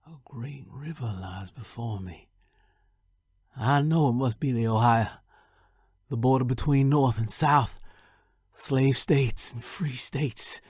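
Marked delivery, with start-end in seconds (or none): 3.6-10.6 swelling declaration. A narrator's reading. Reaching the Ohio river.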